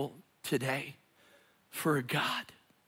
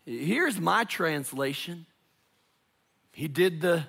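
ordinary talk, nothing marked

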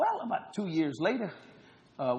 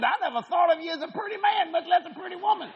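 laughing shout; 10 semitones higher than usual. Retold or imitated. Retold blunt punchline.

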